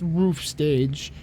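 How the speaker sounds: plain reading voice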